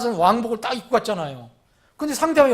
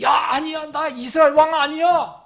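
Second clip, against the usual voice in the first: high, raised cry of denial; mock desperate denial; 8 semitones higher, 6 dB louder than usual. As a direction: yell a hammed-up protest to pursuers. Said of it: "그랬을까요?". (다급하게 외치는 부인 연기)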